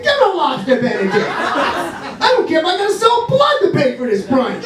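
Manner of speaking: high, frantic big-spender cry; hammed-up eagerness. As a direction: order it recklessly, climbing into a high holler. Acts desperate lovestruck boyfriend.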